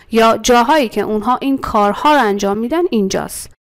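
normal talking voice

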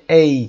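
ای gliding from "e" to "y"; diphthong. Shown as a demonstration. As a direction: join two sounds in ای, "ey". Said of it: "یه صدای دیفتانگ داریم". (دیفتانگ «اِی»، ترکیب ا و ی)

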